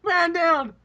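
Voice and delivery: high-pitched mock battlefield cry; mock alarm call. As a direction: call it out like a fallen soldier's alert. Imitates teammate's alarm call.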